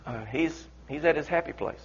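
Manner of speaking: soft, low, hesitant; gentle hushed. Acted. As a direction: break it gently. Soldier breaking bad news.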